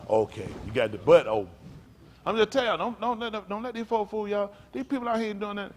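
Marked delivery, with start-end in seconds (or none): 2.3-5.7 high-pitched preaching warning; urgent preaching. Real, not acted. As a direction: warn the congregation on a raised, driving pitch. Preacher rises to warn congregation.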